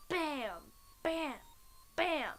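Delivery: banging imitation. Storyteller imitating the killer's renewed slamming, punched bams. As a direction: hit each bam separately.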